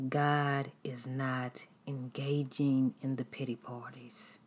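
normal talking voice

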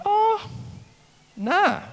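squeaky high scoffing "Oh, nah"; high dismissive oh nah. Retold or imitated. Preacher scoffing at the kid's bad knee claim, high incredulous oh nah.

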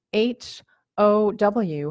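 H O W spelled letter by letter, slowly; spelling it out. Shown as a demonstration. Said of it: "I'll spell it for you".

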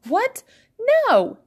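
high shocked question, then loud refusal; appalled cry. A narrator's reading. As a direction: cry out, half appalled, half heartbroken. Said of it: "Fizzy cried".

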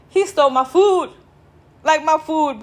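outraged at the theft. Real, not acted.

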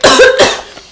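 coughing fit after a sip; sputtering cough, water down the wrong way; choking on a drink. Real, not acted. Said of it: "I choked on the water".

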